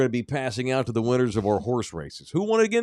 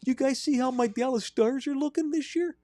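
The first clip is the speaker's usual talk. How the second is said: very high, creepy Wisconsin voice; chirpy high killer voice, staying in character; Ed Gein character; 12 semitones higher than usual. Acted. Host staying in his killer character for the cohosts. Stays in character, hockey chat.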